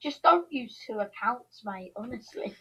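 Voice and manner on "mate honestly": usual speaking voice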